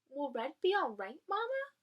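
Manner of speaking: put-on high, small child's question rising at the end; a tiny skunk anxiously asking its mother; worried baby voice. Baby skunk asks Mama about Red, worried high voice.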